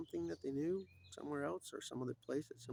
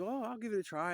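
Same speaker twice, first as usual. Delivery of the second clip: lightly voiced boy, higher and louder; eager boy; 10 semitones higher, 5 dB louder than usual. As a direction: pipe up to volunteer.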